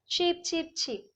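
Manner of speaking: high chick peeps; peeping baby chick. Shown as a demonstration. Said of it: "baby chick sounds".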